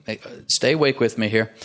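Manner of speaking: ordinary talk, nothing marked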